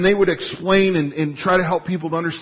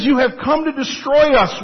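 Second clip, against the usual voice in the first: sarcastic; 8 semitones higher, 4 dB louder than usual. Retold or imitated. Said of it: "with great sarcasm added".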